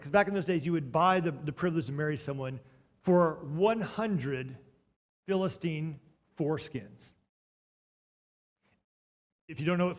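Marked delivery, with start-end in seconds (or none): 3.0-7.1 slow punchline. Shown as a demonstration. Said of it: "slowed punchline".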